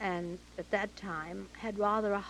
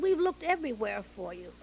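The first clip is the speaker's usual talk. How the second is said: louder, lightly acted exclamation; retold exclamation; 3 semitones higher, 6 dB louder than usual. Director who searched everywhere for Betty, mildly exclaiming. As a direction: stress "everywhere" with emphatic relief.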